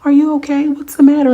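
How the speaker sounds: put-on high, loud worried girlfriend; concerned friend voice. Yandy asking Jessica why she cries, high and worried. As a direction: fuss over her like a worried friend. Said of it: "She's like".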